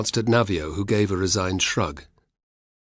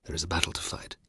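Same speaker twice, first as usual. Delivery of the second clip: urgent hushed whisper; 3 semitones lower, 10 dB quieter than usual. Acted. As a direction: breathe it, barely voiced. Urging calm before a battle.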